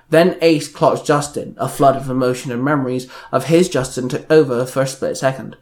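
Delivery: ordinary delivery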